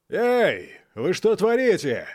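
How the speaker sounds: roaring. Acted. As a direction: bellow it up at them. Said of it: "взревел Вигу".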